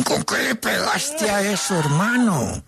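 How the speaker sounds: high, slow, stunned keeper; amazed goalkeeper. Retold or imitated. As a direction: ask it in disbelief. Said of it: "va a decir como Martín".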